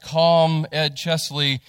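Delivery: ordinary talk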